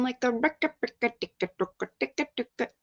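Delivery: quick, soft staccato ticks trailing off a sung line; record-skip ticking. Pretend. Streamer turns the song into a stuck record, playful ticking. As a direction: imitate a skipping record with the tongue.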